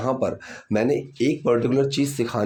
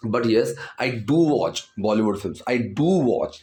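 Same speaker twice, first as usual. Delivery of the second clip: slow, with do given a firm push; stress on do; 2 semitones lower, much slower than usual. Shown as a demonstration. Teacher demonstrating the emphatic do to learners, slow stress. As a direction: insist on do, yes I really do. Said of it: "तो do पर थोड़ा सा जोर दिया गया है".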